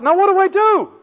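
high, loud flustered question; flustered thought. Retold or imitated. New believer kneeling, not knowing what to do next, high and flustered. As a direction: sound caught out, unsure what comes next.

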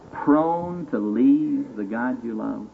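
slow, weighted question. Acted. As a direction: let each word land slowly. Preacher slows on key phrase.

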